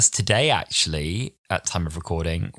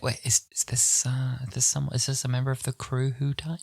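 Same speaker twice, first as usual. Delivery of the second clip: higher, softening, hesitant query; puzzled, halting, higher voice; baffled question; 6 semitones higher, 4 dB quieter than usual. Retold or imitated. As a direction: imitate confused boyfriends asking gingerly. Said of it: "they were just like".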